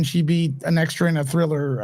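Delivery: usual speaking voice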